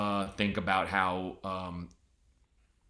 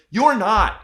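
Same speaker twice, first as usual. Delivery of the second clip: high, loud comic insistence; mock-outraged correction, pitched up; exasperated rebuttal; 8 semitones higher, 7 dB louder than usual. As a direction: set them straight, loudly. Acted rebuttal to the Irish claim.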